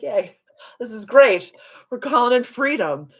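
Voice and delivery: high, cheery mock enthusiasm; gleeful. Driver celebrating calling in freedom with her guides, gleeful. Acting out her earlier glee.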